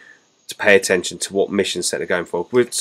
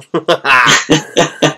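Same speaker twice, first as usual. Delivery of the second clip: laugh; 6 semitones higher than usual. Real, not acted. Laughing after orc voice.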